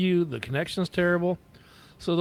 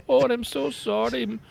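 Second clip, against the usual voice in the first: mock polite Indian accent; 8 semitones higher than usual. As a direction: imitate an overly nice call-center agent. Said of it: "the nicest person ever to me".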